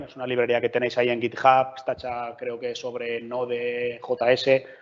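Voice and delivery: ordinary talk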